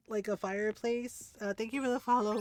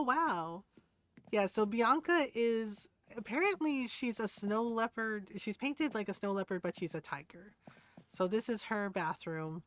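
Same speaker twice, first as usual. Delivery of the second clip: usual speaking voice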